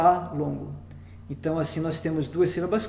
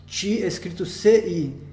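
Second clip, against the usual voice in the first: ordinary delivery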